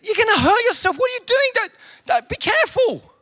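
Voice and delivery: high, urgent dad warning; alarmed dad. Retold or imitated. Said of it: "And I said".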